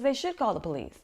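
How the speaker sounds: high, loud retold protest; heated comeback, imitating an outraged friend; indignant retort. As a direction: argue back hotly, voicing the friend. Friend argues back, retold.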